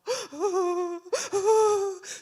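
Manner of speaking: high, loud gasping wail; mock sobs sucked in and wailed out; imitated child crying. Son about to leave, imitated crying gasps. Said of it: "so he's crying".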